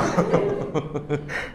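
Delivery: soft unvoiced chuckle; self-mocking chuckle; breathy chuckle. Real, not acted. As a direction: laugh at yourself. Laughs at self.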